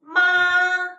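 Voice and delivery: "Ma" held high, loud and level; first tone. Shown as a demonstration. Teacher reviewing the tones, high level "Ma". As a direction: sustain "Ma" on one high pitch. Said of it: "So let's do it again".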